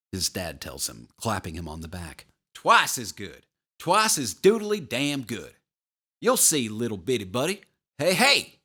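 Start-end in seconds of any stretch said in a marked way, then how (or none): 2.5-8.6 loud, high-pitched booming dad buddy act; big pal voice. A narrator's reading. Dad to his son in the wheelchair, bellowing big pal voice. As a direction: boom it out like an over-hearty pal. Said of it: "Dad's big pal voice".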